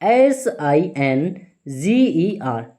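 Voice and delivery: each letter spaced out very slowly; slow dictation of letters; slow spelling. Shown as a demonstration. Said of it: "यहाँ पर ध्यान से देखना है अगले वर्ड को".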